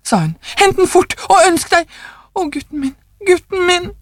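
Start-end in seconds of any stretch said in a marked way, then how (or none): 0.6-1.9 high, urgent order; frantic command. A narrator's reading. Frantic wife urges husband to wish. 2.4-3.9 high lament, first softer then swelling; anguished mother. A narrator's reading. Mother cries for her dead son.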